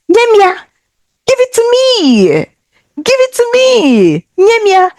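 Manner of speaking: high, pleading give it to me; woman asking. Acted. Scene: woman stretching out her hand to take something, high pleading ask. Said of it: "she says".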